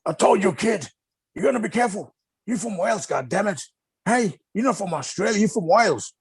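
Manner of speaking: high-pitched mock telling-off; imitated scolding. Telling off a sunburnt Welsh kid in Florida, high mock scolding. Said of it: "he got in trouble".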